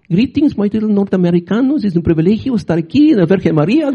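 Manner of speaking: lightly put-on Polish-accented Pope greeting; a hint of the Pope's heavy accent, stately; Pope John Paul accent. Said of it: "he's talking like this".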